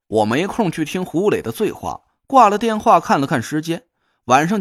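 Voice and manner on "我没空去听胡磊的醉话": ordinary delivery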